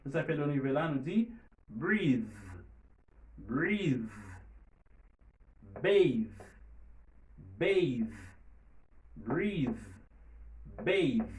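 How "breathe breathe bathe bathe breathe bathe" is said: "breathe", "bathe" ending on a buzzing th; voiced final th. Shown as a demonstration. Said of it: "li sonore". ("breathe", "bathe" ak th sonò nan fen)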